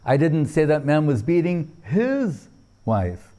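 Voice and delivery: "his" falls and is marked; stress on HIS. Shown as a demonstration. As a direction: hit "his". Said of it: "According to where the emphasis is placed".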